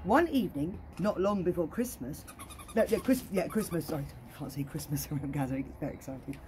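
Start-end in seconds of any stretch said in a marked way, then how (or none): none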